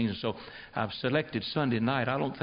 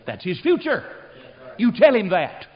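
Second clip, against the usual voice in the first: louder and higher, building fervent preaching; preaching at full force; 6 semitones higher, 8 dB louder than usual. Acted. Preacher warning the congregation of the devil's fate in a loud building preach. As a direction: thunder out the scripture.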